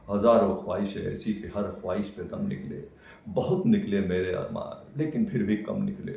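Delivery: loud, declaimed Urdu couplet; poetry recital. Acted. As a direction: declaim the verse grandly, line by line. Recites a couplet brother copied out.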